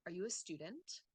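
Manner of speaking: "student" rises at the end; yes-no question intonation. Shown as a demonstration. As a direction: read it out with a lift on STUDENT. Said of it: "yes or no question".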